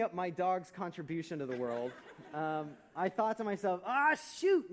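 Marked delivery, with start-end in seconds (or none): 3.9-4.7 loud, high exclamation of exasperation; mock dismay. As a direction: blurt it out as a hammed-up inner groan. Acted inner reaction to dreaded question.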